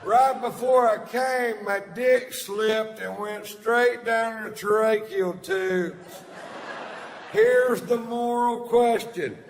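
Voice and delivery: high, loud, booming stage delivery; stand-up shouting. Retold or imitated. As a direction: bellow the old bit to the crowd. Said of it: "dust her off and take her for a spin".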